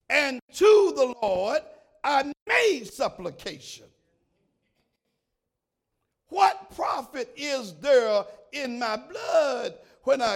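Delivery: loud, high and slow, a full-voiced plea; crying out to God. Preacher voicing the psalmist crying out to God, loud. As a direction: cry it out to the Lord, stretched and loud. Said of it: "he cried out to God".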